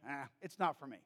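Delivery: low, mildly grumbly dismissal; a touch of grumpy reluctance. Retold or imitated. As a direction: brush it off with a soft grumble. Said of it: "kind of grumbly".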